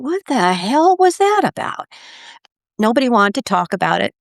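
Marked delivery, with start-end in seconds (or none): 0.0-1.9 baffled. Retold or imitated. Retold bewildered question.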